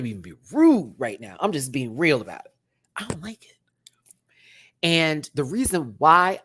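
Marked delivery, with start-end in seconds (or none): 3.0-3.6 whispered confession; hushed, breathy I don't like it. Real, not acted. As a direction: whisper it like a secret.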